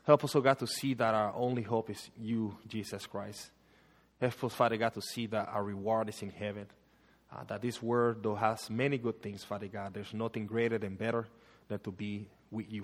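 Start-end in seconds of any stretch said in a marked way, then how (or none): none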